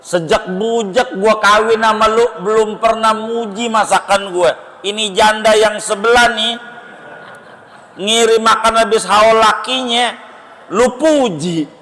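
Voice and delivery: high, mock-indignant wife voice; nagging wife. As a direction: play the offended wife scolding her husband. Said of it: "Itu bini lagi duduk diri".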